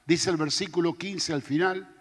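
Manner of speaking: ordinary talk, nothing marked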